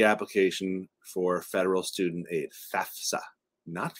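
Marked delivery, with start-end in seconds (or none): 2.7-3.2 "fafsa" said as two syllables, FAF-suh; correct pronunciation. Shown as a demonstration. Host showing viewers the proper name, correct pronunciation. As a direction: say "fafsa" with an f before the s. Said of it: "Some people pronounce it those ways".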